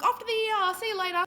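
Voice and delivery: bright breezy farewell; cheery exit. Retold or imitated. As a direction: chirp it and leave. Cheery exit quote to barista.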